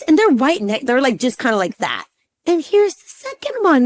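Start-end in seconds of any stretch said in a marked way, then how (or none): 2.4-3.8 high, louder imitation of doctor; doctor pointing out. Doctor showing patient the second heartbeat, high-pitched. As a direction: present it high and emphatic.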